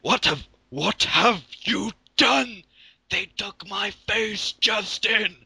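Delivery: put-on high, slow, wailing old wizard; lightly voiced furious headmaster; mock-grief wizard wail, high and slow; hammed-up angry Dumbledore. Dumbledore angry, reading fan fiction.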